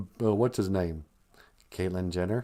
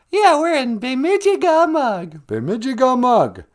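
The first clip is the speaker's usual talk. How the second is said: squeaky high puppet voice, loud; high falsetto wife voice; 12 semitones higher, 6 dB louder than usual. Acted. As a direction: falsetto Michelle puppet greeting. Puppet names their town.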